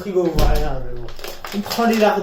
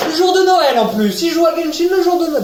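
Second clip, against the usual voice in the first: mocking tone; 9 semitones higher, 5 dB louder than usual. Mocking himself for Genshin at Christmas.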